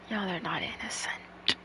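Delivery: soft whispered retort. Real, not acted. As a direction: whisper it back. Whispered reply to story.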